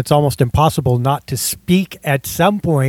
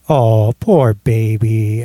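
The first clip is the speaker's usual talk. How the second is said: sarcastic; 5 semitones lower, much slower than usual. Acted. Mocking sympathy about her birthday.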